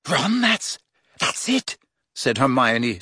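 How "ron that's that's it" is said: high, halting amazement; stunned. A narrator's reading. Girl realises her friend has the answer, stunned. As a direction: falter breathlessly as it sinks in. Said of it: "sounding stunned".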